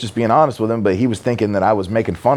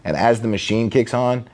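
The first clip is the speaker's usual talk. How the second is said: normal talking voice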